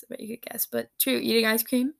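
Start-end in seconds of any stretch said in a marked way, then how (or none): 0.0-0.7 softer, tossed-off repeat; murmured to yourself; quiet aside. Real, not acted. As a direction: drop the voice for a knowing aside. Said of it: "I, I bet you could guess".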